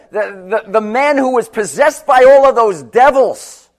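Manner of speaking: full-force sermon climb; rising preaching. Acted. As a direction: preach it with mounting power.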